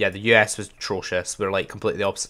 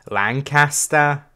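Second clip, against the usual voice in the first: slow, higher Lancashire drawl on Lancaster; mock Lancashire accent; 5 semitones higher, 5 dB louder, much slower than usual. Gamer mocking a Lancashire local in a hammed-up Lancashire accent. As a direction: say Lancaster like a Blackpool local, stretched out. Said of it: "the accents from Blackpool and the Lancaster area are dreadful".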